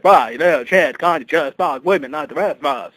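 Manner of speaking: loud, high yelling father; scolding father. Retold or imitated. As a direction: berate the son in disgust. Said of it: "And he's like".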